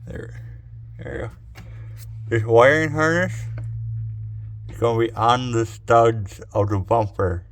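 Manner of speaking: talking around a flashlight in the mouth. Real, not acted. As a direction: mouth full, flashlight held in teeth. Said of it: "I had a flashlight in my mouth".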